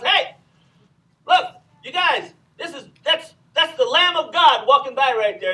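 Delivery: loud, excited call, like a buddy pointing something out; excited casual shout. Retold or imitated. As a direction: shout it to your friends, all worked up. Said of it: "He probably said, there goes Jesus walking by".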